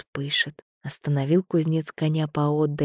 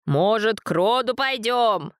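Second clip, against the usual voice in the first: higher shout over the storm; 6 semitones higher than usual. A narrator's reading. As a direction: call out above the wind. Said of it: "снова закричал старец".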